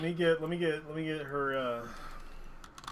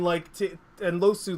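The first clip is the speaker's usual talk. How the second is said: ordinary talk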